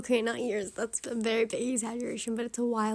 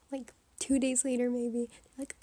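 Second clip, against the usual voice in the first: ordinary talk, nothing marked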